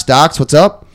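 ordinary talk, nothing marked

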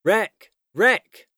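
high-pitched alarm call; urgent cry. A narrator's reading. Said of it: "The cry went up from all around".

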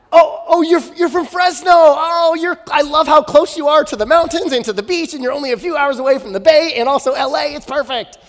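very high, loud torrent of flattery; hammed-up thrilled small talk, piling it on; gushing schmoozer. Prince flattering a visitor from Fresno, gushing schmooze. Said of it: "He would schmooze them".

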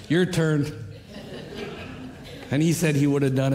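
laughing as you say it; joking, with a laugh. Retold or imitated. Jokingly handing sermon to pastor.

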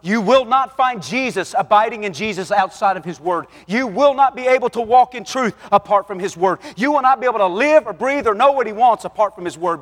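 loud, high, driving sermon voice; full-force preaching. Real, not acted. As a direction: hammer each line home to the congregation.

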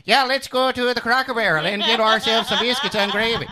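hammed-up cartoon Norwegian lilt; mock Norwegian accent. Co-host showing how she could talk, mock Norwegian accent. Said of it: "Just start having a Norwegian accent".

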